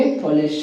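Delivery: unrounded "puh" in पुलिस; corrected pronunciation. Shown as a demonstration. Teacher repeating the answer for students, corrected. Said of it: "क्या बोलेंगे".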